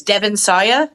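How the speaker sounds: Sawa said as "SIGH-uh", rising questioningly; unsure pronunciation. Real, not acted. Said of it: "Is that how you pronounce it?".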